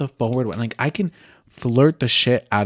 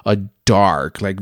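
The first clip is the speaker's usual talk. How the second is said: usual speaking voice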